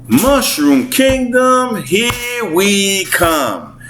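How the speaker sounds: high, drawn-out Brooklyn twang; Chris Pratt's Brooklyn Mario. Retold or imitated. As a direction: imitate a hero's cheer with Brooklyn twang. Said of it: "there's that twang of Brooklyn accent".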